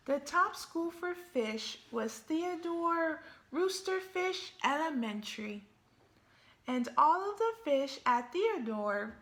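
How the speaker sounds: picture-book storytelling voice; story narration. A narrator's reading. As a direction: read it to the kids with gusto. Reads the story aloud.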